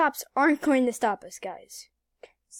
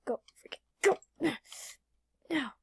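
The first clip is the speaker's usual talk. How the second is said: usual speaking voice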